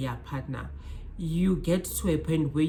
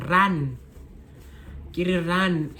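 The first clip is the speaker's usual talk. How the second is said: urgent; 2 semitones higher, slower than usual. Real, not acted. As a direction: warn them off firmly. Urging viewers to run.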